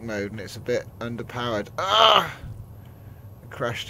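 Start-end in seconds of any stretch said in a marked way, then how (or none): 1.8-2.4 sudden loud yelp; startled yelp. Real, not acted. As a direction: cry out as it crashes. Crash happens.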